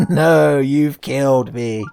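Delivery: slow, put-on wail of a heartbroken fan; slow hammed-up dying fan voice; mock dying fan. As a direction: ham up a melodramatic death line. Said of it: "And he was like".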